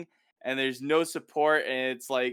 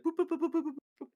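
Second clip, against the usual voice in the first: much higher, fading sing-song boops; playful boop noises; 3 dB quieter, much faster than usual. Retold or imitated. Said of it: "and he's like".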